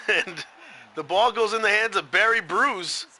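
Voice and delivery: lively sports commentary, punching key words; play-by-play call. Real, not acted. Commentator calls the run into the end zone, excited play-by-play.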